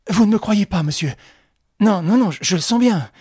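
anxious and pressing, near panic. Acted. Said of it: "d'une voix anxieuse".

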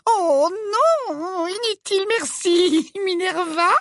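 very high coy simper; sweet little falsetto refusal. A narrator's reading.

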